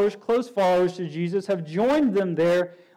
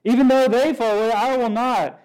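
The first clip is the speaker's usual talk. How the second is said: Peter's cocky vow, a bit higher and louder on Even; proud insistent promise; defiant boast; 4 semitones higher, 4 dB louder than usual. Retold or imitated. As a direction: swear it like nothing could shake you. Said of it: "That's, that's his attitude".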